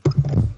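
quick breathy burst of laughter; a snort of laughter; short laugh. Real, not acted. Cohosts puzzle over a phrase, he laughs briefly.

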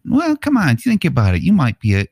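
put-on high-pitched wheedling as a kid; coaxing kid. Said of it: "one of the kids that has been trained to be a troon is like".

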